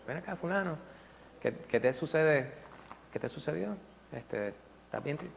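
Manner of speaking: preguntas suaves y bajitas; preocupación tierna. Retold or imitated. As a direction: acercarse con cuidado al alumno. Teacher comforts crying student. (el maestro consuela al alumno que lloraba)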